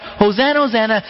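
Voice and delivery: lightly hammed-up acclaim; mock crowd cry. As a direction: echo a cheering crowd. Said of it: "people crying out".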